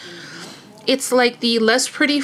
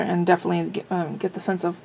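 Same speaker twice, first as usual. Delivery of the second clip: ordinary delivery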